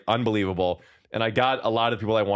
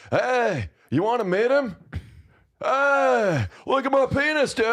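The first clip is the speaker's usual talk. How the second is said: much higher, goofy squeezed character voice; high-pitched dude-bro impression; Sebastian Stan; 13 semitones higher, slower than usual. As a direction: push the voice way up, stretched and silly. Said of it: "I wanted to do my impression of him talking to the penis".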